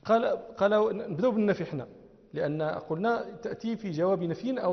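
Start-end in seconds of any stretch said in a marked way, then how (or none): none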